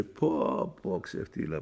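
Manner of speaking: low, soft dismayed aside; humiliated groan. Acted. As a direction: grumble under your breath in shame.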